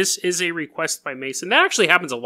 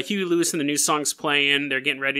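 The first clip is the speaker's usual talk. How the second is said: ordinary delivery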